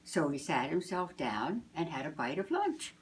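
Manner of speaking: usual speaking voice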